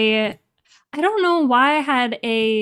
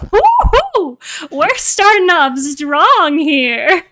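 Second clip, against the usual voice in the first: excited cheer; 5 semitones higher, 6 dB louder, slower than usual. Retold or imitated. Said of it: "I remember reading that in the script and being like".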